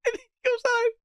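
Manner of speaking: very high, tearful laughing voice; real helpless laughter; crying with laughter. Real, not acted. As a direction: barely get it out while laughing. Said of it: "Oh, God, I'm crying".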